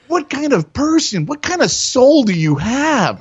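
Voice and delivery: very high, slowed, mock-wounded indignation; outraged plea. Retold confrontation with the dealer.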